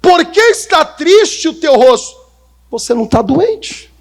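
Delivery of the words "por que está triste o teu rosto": high, louder concerned king; king's voice. Acted. The king asks Nehemiah why he is sad, concerned. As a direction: ask it as the worried king. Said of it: "olha o que o rei falou pra ele".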